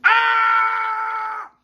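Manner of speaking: hammed-up cartoon howl of pain; Tom and Jerry yell. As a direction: shriek like a cartoon cat whose foot got flattened. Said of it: "be like Tom and Jerry yell".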